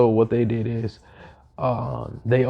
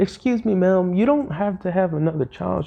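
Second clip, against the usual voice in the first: much higher, lightly voiced polite boy; polite kid voice; 7 semitones higher than usual. The boy politely corrects the mother, higher voice. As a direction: ask sweetly like a well-mannered kid. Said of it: "He said".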